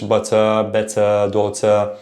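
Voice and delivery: British "butter, better, daughter" with crisp T and no final R; British English. Shown as a demonstration. Teacher contrasts accents, British English T. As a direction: say them in a British accent, T pronounced. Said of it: "maybe British English".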